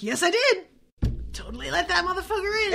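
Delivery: high, loud, proud mock knight; cheerfully unrepentant character voice; Sir Cadogan. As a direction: blurt it out gleefully high. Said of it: "Sir Cadogan's like".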